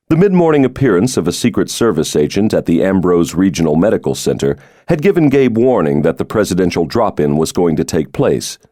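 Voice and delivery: normal talking voice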